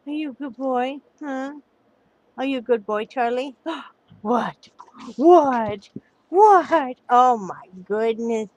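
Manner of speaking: loud rising doting coo; talking to a dog. Real, not acted. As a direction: tease the dog playfully. Cooing at dog.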